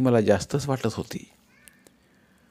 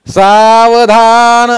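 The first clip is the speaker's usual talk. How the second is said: high, drawn-out ceremonial cry of "Saavdhaan"; loud priestly shout; 10 semitones higher, 13 dB louder, much slower than usual. A narrator's reading. Priest ending the wedding verses over the couple, loud drawn-out shout. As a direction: shout it long and high, holding the vowels. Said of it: "ब्राह्मण महाराज जोरात ओरडले".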